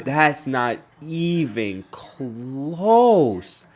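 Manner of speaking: very slow, drawn-out echo of a friend; mocking repeat. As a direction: stretch each word out, milking it. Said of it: "he said".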